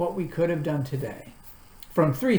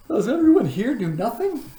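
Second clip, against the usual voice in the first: high, loud puzzled question; surprised question; 8 semitones higher, 7 dB louder than usual. A narrator's reading. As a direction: ask it in disbelief. Milo asks, surprised.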